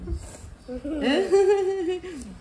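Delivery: high, giggly run of laughter; long, high amused giggle; delighted laugh. Real, not acted. First time Coco carries baby.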